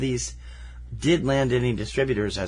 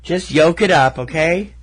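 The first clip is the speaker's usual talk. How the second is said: higher, louder mock scolding; mock exasperation; 5 semitones higher, 7 dB louder than usual. Co-host firing back an egg pun, mock exasperation. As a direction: playfully push the pun at them.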